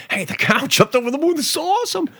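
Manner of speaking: high, gushing delight with a laugh; mock glee. Host mocks a delighted Trump fan, with high gushing glee. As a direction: gush like a thrilled fan.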